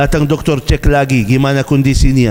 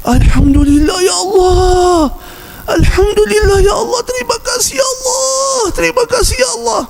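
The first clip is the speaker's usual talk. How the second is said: very high crying shout of gratitude; tearful thanks. Pretend. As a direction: cry out your thanks to God in tears. Imagined tearful thanks after bathroom. (syukur sambil menangis dan berteriak tinggi)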